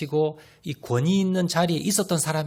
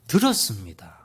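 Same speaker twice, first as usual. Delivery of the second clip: dramatic whispered answer; 7 semitones lower than usual. Shown as a demonstration. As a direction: drop to a whisper for the punchline. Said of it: "whispered reveal".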